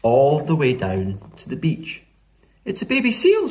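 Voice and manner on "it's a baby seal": high, excited discovery voice; higher-pitched eager Flo. A narrator's reading. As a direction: exclaim it in a high, lively voice. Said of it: "said Flo".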